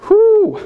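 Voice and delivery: sharp high-pitched whew of disbelief. Real, not acted. Pastor reacting to the crowd's hands, high amazed whew. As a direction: let out a squeaky amazed whew. Reacting to coffin bug votes.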